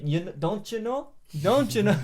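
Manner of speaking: imitating a Canadian "don't you know"; mock Canadian accent on "don't you know"; light Canadian impression. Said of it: "the most Canadian dude ever".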